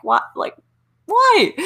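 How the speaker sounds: high, loud burst on "why"; exasperated, squeaky-high "why?"; incredulous. Retold or imitated. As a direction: recount it in disbelief, pitch leaping up. Retelling reaction to stolen ideas.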